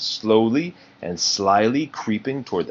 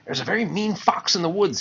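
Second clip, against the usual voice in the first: warning skunk; 9 semitones higher than usual. Acted. Flower warns Bambi and Thumper, high and hushed. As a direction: pitch up and warn gently. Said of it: "he warned".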